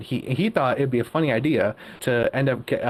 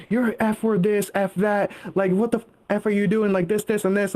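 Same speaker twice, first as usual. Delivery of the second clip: higher-pitched mock tirade; retold bickering; 6 semitones higher than usual. Friend acting out the manager Callie bickering with Aaron, mock angry. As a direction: imitate her ranting in a raised pitch. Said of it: "so then they start bickering".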